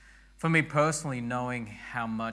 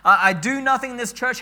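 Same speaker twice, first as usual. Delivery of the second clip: voicing a discouraged member; 12 semitones higher, 15 dB louder than usual. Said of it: "you're like".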